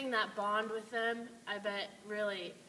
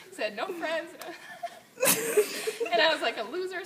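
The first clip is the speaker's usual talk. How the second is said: laughing; 9 semitones higher, 7 dB louder than usual. Real, not acted. Laughs through embarrassing story.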